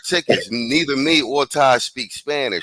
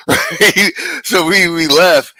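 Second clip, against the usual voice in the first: laughing; 6 semitones higher, 5 dB louder, slower than usual. Real, not acted. Laughing at leaving early.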